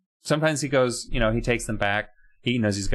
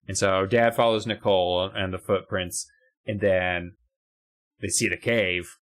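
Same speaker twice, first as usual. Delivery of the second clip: normal talking voice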